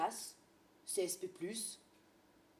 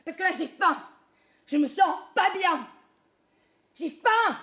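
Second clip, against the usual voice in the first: loud whining; 8 semitones higher, 12 dB louder than usual. Acted. Hunger complaint escalating.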